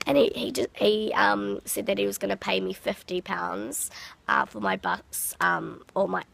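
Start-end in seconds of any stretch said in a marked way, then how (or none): none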